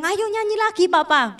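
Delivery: high-pitched, lightly voiced child urging papa; sick child's plea. Sick toddler asking papa to sing, lightly imitated. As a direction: call out like an eager little child.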